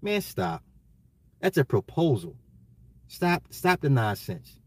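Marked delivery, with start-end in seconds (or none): none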